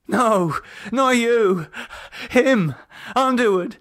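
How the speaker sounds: high, frantic man's voice; terrified. A narrator's reading. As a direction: plead in rising fear. David frightened.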